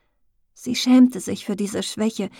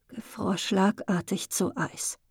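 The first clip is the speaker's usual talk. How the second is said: plain reading voice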